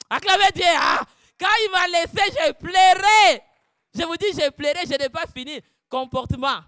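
loud, high preaching; impassioned sermon; preaching at full force. Acted. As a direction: cry out the testimony.

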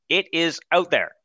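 normal talking voice

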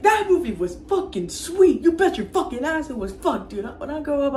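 high, gushing movie-goers; excited retold fans. Kids leaving the Terrence and Phillip movie, excited. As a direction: gush like kids fresh from a great film. Kids walk out loving film.

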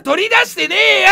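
loud playful denial; comic fake anger; mock angry retort. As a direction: snap back, clearly for laughs. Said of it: "切れる生配信".

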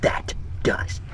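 breathy whispered aside, barely voiced. Real, not acted. Streamer whispering to himself after shouting at the ammo. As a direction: whisper it to yourself after the outburst. Muttered reply to own outburst.

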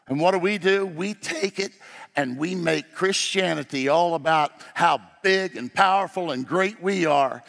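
ordinary talk

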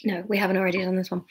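quick whispered aside. Real, not acted. Reader whispering a quick aside to herself. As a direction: whisper it fast to yourself.

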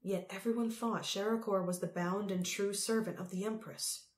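ordinary delivery, nothing marked

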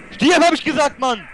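high, loud angry outburst; loud gamer rage; angry yell. Real, not acted. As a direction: yell it at the enemy, fed up. Angry yelling at game opponent.

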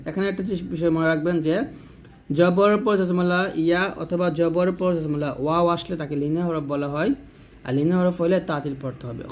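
ordinary talk

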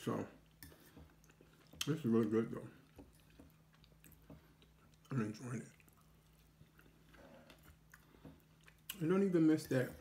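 eating between words; chewing while talking. Real, not acted. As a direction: mouth partly full of food. Seen 1: Bite taken at -4; jaw closed, chewing through the words, fork loaded.

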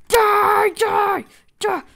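loud, high battle cries; attacking shout. Pretend. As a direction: shout it as you strike. Attacking mobs in game.

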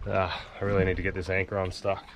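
ordinary delivery

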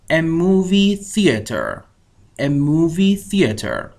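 movie theater drawn out slowly, syllable by syllable; slow model phrase. Shown as a demonstration. Teacher modelling for students to repeat, slow and clear. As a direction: spell it out with the voice, slowly. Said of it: "همراه من تکرار کنید".

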